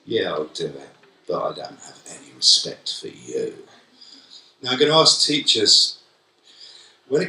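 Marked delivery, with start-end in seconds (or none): 0.0-3.7 contemptuous mutter. Retold or imitated. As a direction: sound like they're looking down on you. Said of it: "as if you're a piece of dirt".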